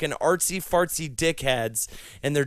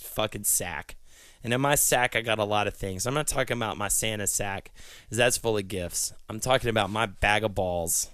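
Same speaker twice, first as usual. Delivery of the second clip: ordinary talk, nothing marked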